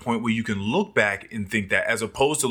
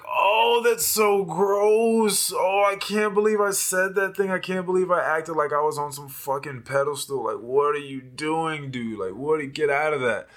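self-disgust; 5 semitones higher than usual. Retold or imitated. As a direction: recoil at your old self. Disgust at own past self.

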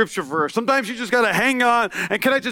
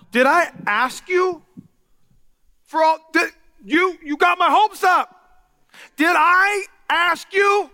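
higher, slow, hard-edged accusing; street-tough confrontation; 8 semitones higher, slower than usual. Acted. Grieving mother confronts the prophet, street-tough and accusing. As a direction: throw it back at him, east side style. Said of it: "I went a little east side there".

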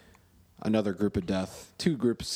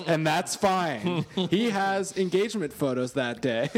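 higher, slow teasing fiancee voice; mock fiancee; 7 semitones higher, slower than usual. Retelling his fiancee's jab at the table, slow and higher. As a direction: play the fiancee, drawn out and pointed.

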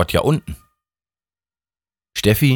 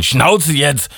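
snapping; 7 semitones higher than usual. Acted. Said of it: "blaffte Gott".

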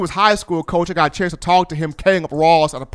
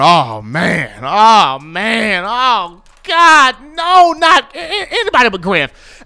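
stadium fans dreading the kick, groaning; nervous crowd groan; 2 semitones higher, slower than usual. Retold or imitated. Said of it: "everybody in the stadium's going".

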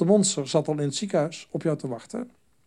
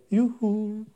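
higher, lightly voiced call from afar; a hint of a sly inner voice; little tempting voice; 7 semitones higher, slower than usual. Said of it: "Stemmetje klinkt zo".

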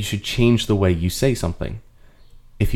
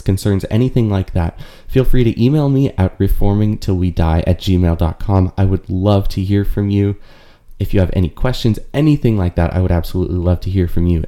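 upbeat, a little louder sign-off to listeners; podcast outro; 5 dB louder than usual. Acted. Host signs off the podcast episode to listeners, bright outro read. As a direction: warmly invite listeners to like and subscribe.

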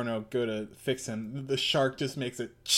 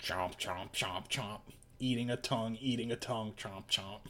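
chomping; 4 semitones lower, 8 dB quieter than usual. Pretend. Imitates shark eating tongue.

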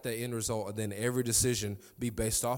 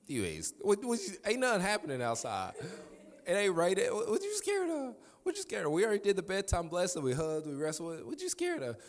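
high, disbelieving daddy voice; dad asking his scared son what's wrong; high incredulous dad; 7 semitones higher than usual. Retold or imitated. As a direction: pitch way up in mock disbelief. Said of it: "And I'm like".

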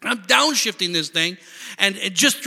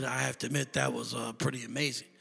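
soft, low reluctant admission; husband grudgingly admitting to wife, quiet; 6 semitones lower, 12 dB quieter than usual. Retold or imitated. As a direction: admit it sheepishly, softly.

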